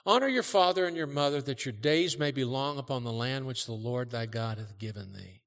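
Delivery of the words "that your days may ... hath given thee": usual speaking voice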